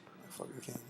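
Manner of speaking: hushed, boastful whisper; soft whispered brag about his hat. Real, not acted. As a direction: whisper it like a proud secret. Whispering, people asleep in house.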